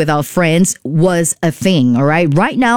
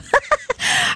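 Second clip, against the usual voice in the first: soft, airy high giggle; a quick embarrassed giggle; breathy giggle; 6 dB quieter than usual. Real, not acted. Host giggling after revealing her cousin was a DJ. After confessing club secret.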